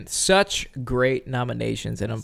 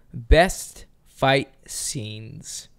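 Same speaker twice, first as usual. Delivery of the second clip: slow dramatic announcement; much slower than usual. Acted. Announcing category title.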